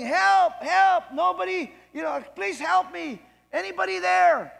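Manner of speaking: loud, high, desperate shouts; man hanging on a branch shouting for anyone; yelling for help. Acted. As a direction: keep screaming for rescue. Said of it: "He kept yelling".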